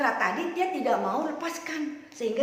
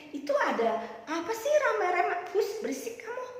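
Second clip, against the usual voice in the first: higher-pitched annoyed bystander; orang ramai kesal; 9 semitones higher than usual. Acted. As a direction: shush them in a higher, bothered voice. Acted bystander question and shushing. (suara tinggi kesal, menghardik)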